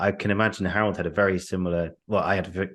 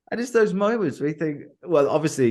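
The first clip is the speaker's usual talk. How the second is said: still amused; 9 semitones higher than usual. Real, not acted. Still amused after story.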